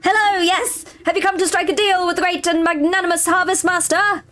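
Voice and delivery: a grand, eager scarecrow hailing you. Acted. Scarecrow greets the player.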